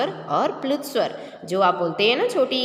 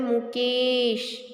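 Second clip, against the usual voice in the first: drawn-out calling; much slower than usual. Shown as a demonstration. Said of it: "जब आप बुलाते हैं".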